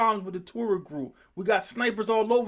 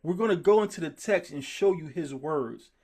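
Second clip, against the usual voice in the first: ordinary talk, nothing marked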